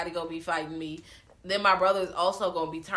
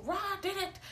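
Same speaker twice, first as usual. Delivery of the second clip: high, softer tattling cry; mock accusers; 13 semitones higher, 6 dB quieter than usual. Host voicing friends telling police, mock accusing. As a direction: imitate friends pointing blame. Acts out the friends blaming Rod.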